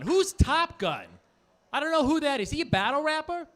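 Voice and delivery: brash New Jersey bewilderment at every title; New Jersey guy. Pretend. Comic doing a Jersey guy baffled by movies. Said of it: "attempt at an impression of a guy from New Jersey who's never seen a movie".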